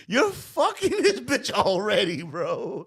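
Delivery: ordinary delivery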